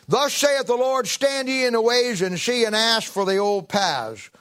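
high, slow preacher's proclamation; ringing, drawn-out pulpit reading; scripture declamation. Acted. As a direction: proclaim the verse slowly, pitched well up.

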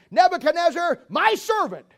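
high, loud, emphatic declaration; proclaiming. Acted. God calling a pagan king his servant, proclaimed loud. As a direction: proclaim it loudly, pitched high. Preacher voices God's emphatic call.

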